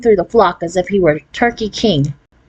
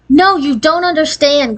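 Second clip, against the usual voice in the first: crying out; 8 semitones higher than usual. A narrator's reading. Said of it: "cried Deanie".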